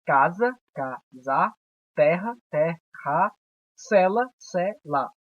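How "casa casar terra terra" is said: casa and terra drawn out slowly syllable by syllable; slow syllable demo. Shown as a demonstration. Teacher dictating two-syllable examples, slowly. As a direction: sound each word out slowly. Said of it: "Duas sílabas, dissílabas".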